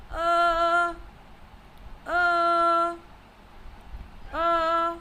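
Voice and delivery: "uh" held on a high, unchanging note; first tone on uh. Shown as a demonstration. Teacher demonstrating first tone, "uh" long and level. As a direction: sing each "uh" steadily without any change in pitch. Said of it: "if singing a high tone".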